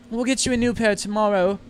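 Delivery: a hint of a mother comforting her daughter; soothing mother.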